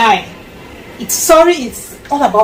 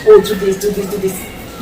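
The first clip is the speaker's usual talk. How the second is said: instructing parent; 10 semitones higher than usual. Acted. Voices a parent instructing a child.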